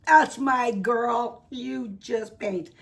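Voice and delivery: loud, slow cheering; encouraging. Retold or imitated. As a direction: cheer her on, slowly. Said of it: "I said".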